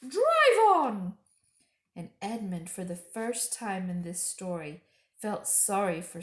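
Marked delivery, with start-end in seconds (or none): 0.0-1.1 high, loud icy witch order; the witch's command. A narrator's reading. Witch ordering the dwarf to drive, loud and high. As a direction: bark it like a cruel queen.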